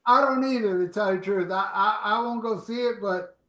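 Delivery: ordinary delivery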